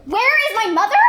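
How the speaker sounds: loud, impatient echo; exasperated. Acted. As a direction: throw the question back. Juliet exasperated.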